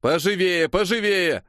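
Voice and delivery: high-pitched hurry-up call; commanding. A narrator's reading. Assistant director hurrying extras into place, commanding. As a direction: urge the crowd along. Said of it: "командовал помощник режиссёра".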